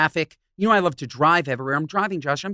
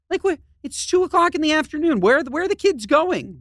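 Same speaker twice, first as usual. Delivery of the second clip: high, baffled disbelief; 10 semitones higher than usual. Real, not acted. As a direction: ask in high exasperated disbelief. Exasperated rant about buses.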